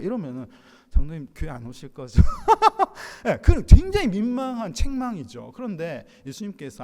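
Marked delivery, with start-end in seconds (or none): 2.3-3.2 high, loud burst of laughter; short high belly laugh; hearty laugh. Real, not acted. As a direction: laugh out loud at your own example. Laughs at his awkward elder example.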